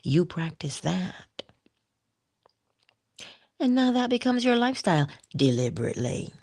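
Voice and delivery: ordinary talk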